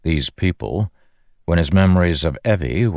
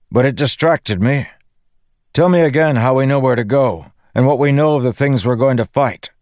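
high, raised calling voice; shouting; 9 semitones higher, 4 dB louder than usual. A narrator's reading. Dalinar asking his companion for a briefing mid-flight, shouting. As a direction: keep shouting over the wind. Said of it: "Dalinar shouted".

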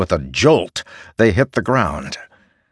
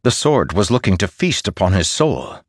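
ordinary delivery

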